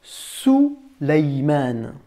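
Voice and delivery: Souleyman slow, in long syllables Sou-ley-man; Arabic pronunciation. Shown as a demonstration. Teacher gives pupil the Arabic name for Salomon, saying Souleyman slowly by syllable. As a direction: draw out each syllable of Souleyman. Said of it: "on va dire Souleyman".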